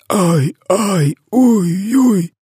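very high-pitched howls of pain; roaring wail. A narrator's reading. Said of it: "ревів тілогриз". (високе болісне завивання)

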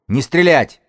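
high, loud command; shouted order. Acted. Gang's elder orders his people not to shoot, loud shout. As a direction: order everyone to hold fire.

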